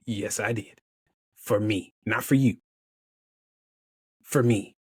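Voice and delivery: low-pitched and measured, with long pauses. Retold or imitated. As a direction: let each short phrase land gently. Insisting the visit was for himself.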